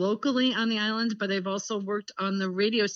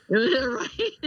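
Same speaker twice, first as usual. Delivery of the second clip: usual speaking voice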